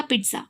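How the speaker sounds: पिज़्ज़ा as "pit-sa", with a t in the middle; pronunciation model. Shown as a demonstration. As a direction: say पिज़्ज़ा softly with a clear ts. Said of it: "क्या pronunciation है इसका".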